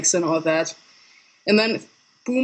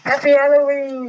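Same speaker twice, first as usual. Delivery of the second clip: spooky Halloween greeting; 8 semitones higher, 5 dB louder than usual. Pretend. Echoing the costume greeting.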